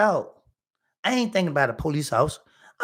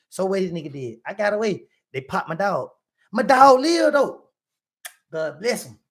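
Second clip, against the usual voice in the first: ordinary talk, nothing marked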